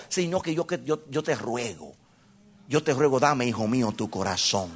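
tender plea. Acted. God's plea voiced softly.

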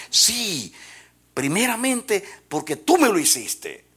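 put-on child explaining. Boy explaining to father.

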